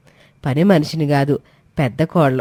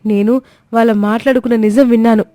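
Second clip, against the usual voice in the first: high and louder, insisting on the truth; protesting young wife; 7 semitones higher, 4 dB louder than usual. Acted. Komali protesting against being called arrogant, high and loud. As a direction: refuse the accusation, desperate. Daughter-in-law protests accusation.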